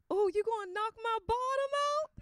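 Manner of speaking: high, teasing coo; coy high wife. Retold or imitated. Wife teasing her husband back, high and coy.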